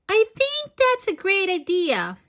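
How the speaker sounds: Nanny's voice. A narrator's reading. Big sister Nanny agreeing to David's surf idea, lightly voiced. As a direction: chime in as Nanny, a touch brighter.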